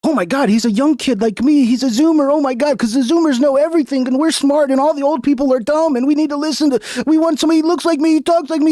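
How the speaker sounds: high, fast mock Zoomer voice; mock fanboy kid. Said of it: "They're like".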